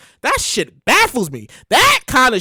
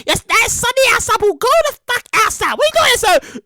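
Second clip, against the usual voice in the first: scolding parent; 9 semitones higher than usual. Retold or imitated. Parent ordering a kid outside, high scolding. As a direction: chase the kid out of the house. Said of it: "Your parents said".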